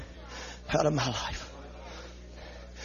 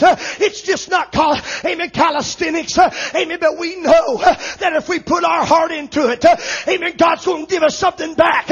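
full-force preaching; 7 semitones higher than usual. Acted. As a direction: drive every line home like a revival preacher.

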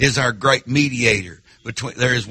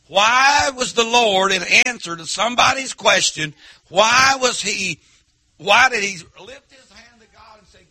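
preaching; 6 semitones higher than usual. Acted. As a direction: lift the question to the whole church. Preacher builds his question.

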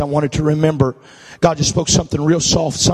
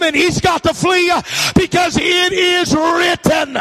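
preaching at full force; 11 semitones higher than usual. Real, not acted. Preacher urging the congregation to read scripture, full-force preaching. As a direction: whip the congregation up to a roaring peak.

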